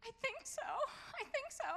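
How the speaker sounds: high, tearful squeak, slowing down; small high crying voice, mimicked; imitating sobbing daughter. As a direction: play the daughter choking out the words through tears. Sobbing daughter answering mom.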